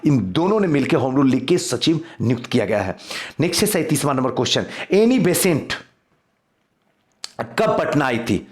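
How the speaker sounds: ordinary talk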